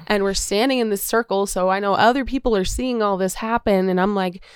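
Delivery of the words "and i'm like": ordinary talk, nothing marked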